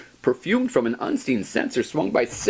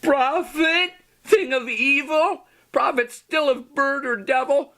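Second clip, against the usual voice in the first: much higher, urgent accusation; frantic cry; 4 dB louder than usual. Acted. Narrator accusing the raven, high and frantic. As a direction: push the words up high in alarm.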